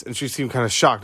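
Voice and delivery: ordinary delivery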